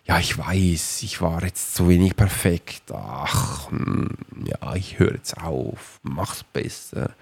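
soft, slow, sinking into a resigned mumble; deflated self-doubt. Retold or imitated. Lisa doubting herself after her take, trailing off softly. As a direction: let the voice drop away as if giving up. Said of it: "dann sagt Lisa".

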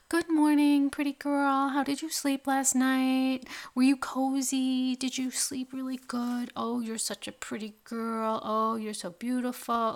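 put-on high, sweet baby talk to a pet dog; dog mom cooing good morning to her dog; cutesy dog voice. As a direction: coo at your dog like she's a baby. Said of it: "like, high pitch, like, cutesy voice".

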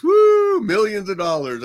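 high, loud mock cheer for the prize; hammed-up sweepstakes excitement; mock excited whoop. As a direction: ham up the scammer's big win. Mocking the caller's sweepstakes pitch.

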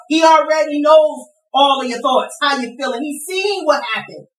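high-pitched fervent sermon cry; impassioned preaching. Real, not acted. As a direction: preach it out with soaring urgency. Preaches at full force.

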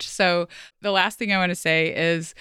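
ordinary talk, nothing marked

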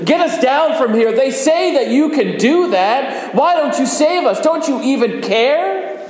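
a dying man's furious shout at Jesus; yelling at Jesus. Acted. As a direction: hurl it at him in bitter anger. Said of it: "Then James starts to yell at Jesus too".

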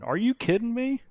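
high, squeaky are-you-kidding-me; Are you kidding me, sky high and teasing; mock disbelief. Coach to doubting client, high incredulous squeal.

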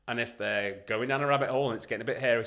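ordinary delivery

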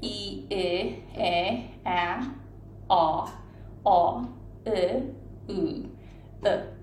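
English vowel drill, one sound at a time; isolated English vowels. Shown as a demonstration. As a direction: practise each English vowel separately with pauses between. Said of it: "一個一個の音を練習しますよね、こうやって".

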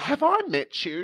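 high, airy-volume "do you know who I am" act; mock celebrity. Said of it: "acting like I was the celebrity".

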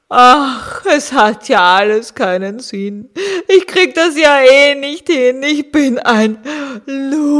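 sniffly, tearful walrus; sniffling. Acted. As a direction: sniff through the words. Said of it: "schniefte das Walross".

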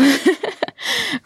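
high, short burst of laughter; real laugh. Real, not acted. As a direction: laugh lightly, pitched well up.

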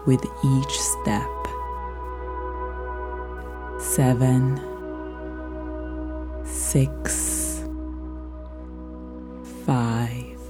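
long pauses, soft and whispered numbers; slow soothing countdown. Acted. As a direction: count them down into sleep. Counting down stairs into sleep.

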